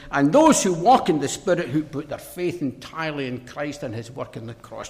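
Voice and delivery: normal talking voice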